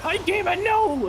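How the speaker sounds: very high put-on character squeal; high-pitched mock panic; Winslow's alarmed protest. Voicing Winslow protesting in a story.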